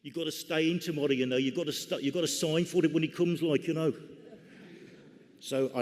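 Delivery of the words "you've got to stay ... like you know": imitating a broad Brummie voice, higher; mock Birmingham accent on the phone. Bullion dealer on the phone, mock Brummie accent. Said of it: "this broad Brummie accent said to me".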